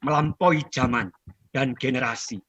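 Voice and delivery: dramatic recital, phrases lifted and then falling away; stately, heartfelt poem reading; poetry recitation. Acted. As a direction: declaim a poem line by line with long pauses. Recites own poem to audience.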